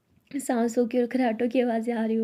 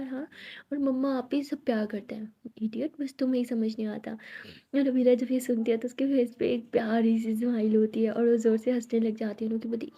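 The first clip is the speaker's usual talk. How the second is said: ordinary delivery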